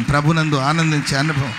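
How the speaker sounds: ordinary talk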